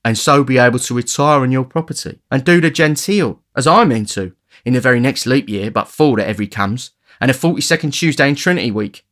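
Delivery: a hint of a mocking young pickpocket; lightly voiced cheeky boy. A narrator's reading. Charlie mocking Oliver's hopes, lightly voiced and cheeky. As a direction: tease him with a joke.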